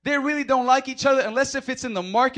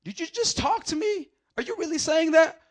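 incredulous; 7 semitones higher than usual. Retold or imitated. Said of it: "It's more like".

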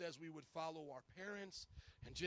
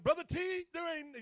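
higher, louder imitated Brother T speech; Brother T's way of talking; 9 semitones higher, 10 dB louder than usual. Pastor talking like Brother T to protest, high and loud. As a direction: plead loudly in Brother T's dialect. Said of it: "you had to talk like Brother T".

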